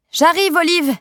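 put-on high, loud call to the baby in another room; cheerful shout across the flat. A narrator's reading. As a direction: call out so the baby hears you. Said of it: "je crie".

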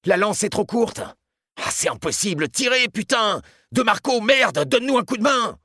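high, strained cries of urgency; desperate shouting. A narrator's reading. Guards with too short a hose facing a fire, shouting desperately. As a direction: cry out for help, swearing in desperation.

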